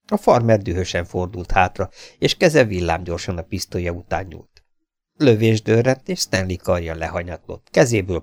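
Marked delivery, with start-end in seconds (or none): none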